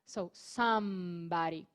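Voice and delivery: slow somebody with a clear M; model pronunciation. Shown as a demonstration. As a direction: say somebody slowly, closing the lips. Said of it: "é assim que é uma pronúncia mais interessante". (somebody devagar com M nítido)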